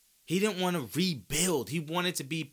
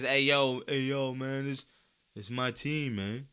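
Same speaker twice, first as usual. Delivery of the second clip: Kawhi impression. Mocking Harden, a lightly laid-back Kawhi voice. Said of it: "That's my Kawhi impression".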